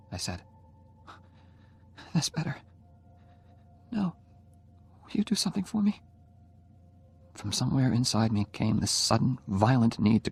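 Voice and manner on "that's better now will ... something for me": put-on higher young woman's voice, calming down; gentle now, asking a favour; dying girl asks Oliver for something, higher voice; Jenny's voice, softened. A narrator's reading.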